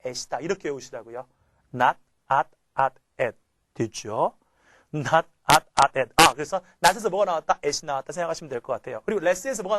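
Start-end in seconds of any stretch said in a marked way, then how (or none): 1.7-3.4 "Not" broken into "at", punched out loud; sound-by-sound breakdown. Shown as a demonstration. Teacher drilling a memory trick by sound, sound-by-sound breakdown. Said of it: "발음으로 한번 외워봅시다". 4.9-6.1 "Not at, at, at" in loud separate beats; sound-by-sound breakdown. Shown as a demonstration. Teacher repeating the sound trick for students, sound-by-sound breakdown. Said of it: "발음으로 한번 외워봅시다".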